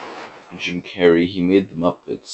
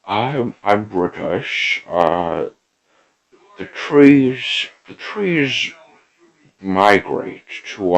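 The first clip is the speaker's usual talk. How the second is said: hammed-up British nature narrator, higher pitched; a silly British narrator voice, pitched up; mock Attenborough; 4 semitones higher, slower than usual. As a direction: play a rambling posh documentary host. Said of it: "David Attenborough? ... and he said".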